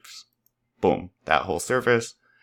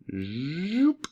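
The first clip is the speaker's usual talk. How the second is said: high, soft rising zoop; playful zoop; 8 semitones higher, 5 dB quieter than usual. Acted. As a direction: swoop up like a toy rocket. Playful sound effect while scaling.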